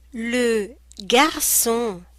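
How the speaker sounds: "le garçon" very slow, ç as clear S; slow textbook pronunciation. Shown as a demonstration. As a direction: draw out "garçon" syllable by syllable. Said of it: "S, S".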